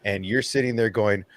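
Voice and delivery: normal talking voice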